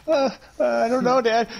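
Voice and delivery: put-on high, hesitant kid voice, way up in pitch; scared little boy. Son answering his intense dad, high timid kid voice. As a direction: stammer it out like a timid child. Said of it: "Timmy's just like".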